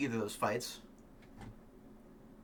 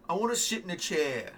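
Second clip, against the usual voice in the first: mock Gary voice; 5 semitones higher than usual. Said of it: "He might be like".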